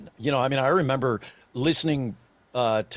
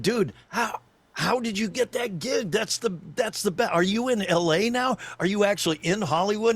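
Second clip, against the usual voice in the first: higher-pitched, impressed questions; mock astonishment, pitched up; amazed friends; 7 semitones higher than usual. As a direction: imitate excited pals on the phone. Retelling amazed friends phoning him.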